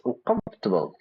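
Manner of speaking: uncomfortable said as UNCOMF-ter-ble, three syllables. Shown as a demonstration. Teacher repeating the right way to say uncomfortable. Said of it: "Three syllables".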